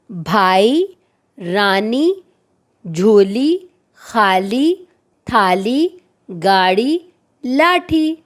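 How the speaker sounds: each word drawn out with a rising lilt, the last one falling; careful word drill. Shown as a demonstration. Teacher reading the word list to young pupils, slow drill voice. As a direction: read the list slowly like a teacher drilling a class. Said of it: "اسی طرح ہم چار حرفی الفاظ دیکھیں گے".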